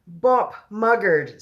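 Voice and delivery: slow, high, loud screech. A narrator's reading. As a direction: screech out each word as a giant. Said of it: "screeched the butcher boy".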